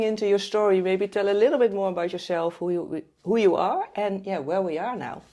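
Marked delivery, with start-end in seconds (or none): none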